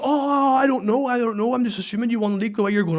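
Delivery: high-pitched feigned ignorance; sing-high excuse, imitating a wind-up; mock innocence. Groundsman teasing celebrating coach, high mock innocence.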